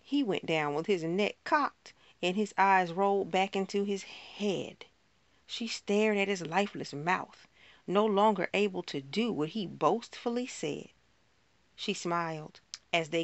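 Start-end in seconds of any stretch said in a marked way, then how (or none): none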